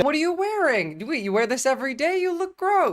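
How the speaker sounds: high, mocking sneer; sneering paramedic. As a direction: look him up and down and sneer it. (a mock paramedic mocking the patient's clothes, high and sneering)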